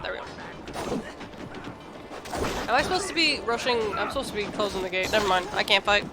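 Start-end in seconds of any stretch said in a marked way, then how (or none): none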